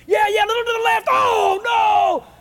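high, loud, frantic golf-fan shouting; yelling, freaking out. Retold or imitated. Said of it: "I'm yelling and freaking out".

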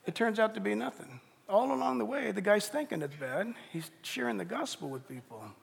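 ordinary talk

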